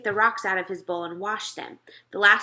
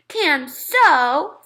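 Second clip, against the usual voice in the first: put-on higher, louder stubborn toddler; little Fudge; 7 semitones higher, 8 dB louder than usual. A narrator's reading. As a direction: insist like a small child. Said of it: "Fudge said".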